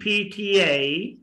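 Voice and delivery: slow P T A as "phee-tee-yay"; drawn-out P T A for copying; say after me. Shown as a demonstration. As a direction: stretch and link P T A. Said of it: "try to say after me".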